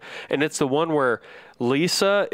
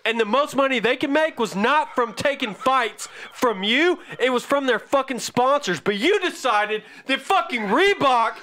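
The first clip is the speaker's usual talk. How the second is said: raised, heated tirade, pitched high throughout; furious rant; 5 semitones higher than usual. Real, not acted. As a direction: unload on him, voice climbing with rage. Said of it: "I thought I got wound up about shit".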